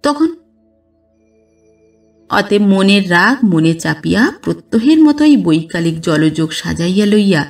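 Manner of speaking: plain reading voice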